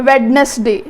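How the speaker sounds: ਵੈਡਨਸਡੇ in three parts, wed-nes-day; common mispronunciation. Shown as a demonstration. Teacher voicing how many people say it, common mispronunciation. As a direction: sound the d in ਵੈਡਨਸਡੇ. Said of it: "ਇਹਨੂੰ ਅਸੀਂ ਕੀ ਕਹਿੰਦੇ ਹਾਂ".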